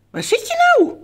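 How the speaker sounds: very high, loud pet-talk squeal; excited high-pitched "where are you"; playful searching call. Pretend. Calling the hidden pet bird.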